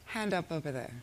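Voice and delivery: usual speaking voice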